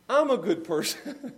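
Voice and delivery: real laughter breaking into the mock quote; laughing through it. Real, not acted. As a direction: try to quote it but crack up. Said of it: "I can't even say it without laughing".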